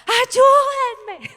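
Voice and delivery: high loud scream for help; imitating her scream. The daughter in the operating room yells for help, high and loud. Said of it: "dice ella que gritaba".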